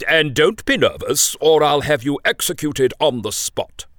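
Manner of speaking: threatening king. Acted.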